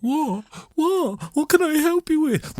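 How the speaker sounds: very high-pitched, plaintive bleat; flustered squeak. Acted. Ben answering the demanding listeners, flustered. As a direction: squeal it like someone cornered.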